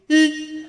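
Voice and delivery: high, loud sustained "ee" squeal; mock laugh like a ship's horn; mum's one-note laugh. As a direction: imitate a laugh as one piercing beep. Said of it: "Is that your mum or a ferry's coming in?".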